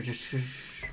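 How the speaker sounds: quiet, hushed shh; soft shush. Acted. Beryl hushing Plumble during a tense walk, soft shush. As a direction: tell them to hush softly. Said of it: "Beryl will say".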